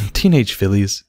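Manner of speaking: ordinary delivery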